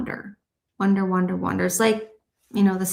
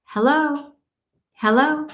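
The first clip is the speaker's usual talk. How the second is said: higher, lilting hello; raised-pitch mimicked female caller; 6 semitones higher, slower than usual. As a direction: imitate a woman calling out a questioning hello. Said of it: "a female voice say".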